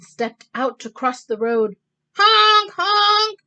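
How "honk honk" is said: loud high honking; car horn honks. Acted. Speeding cars honk at the ducks, loud and high. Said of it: "went the horns on the speeding cars".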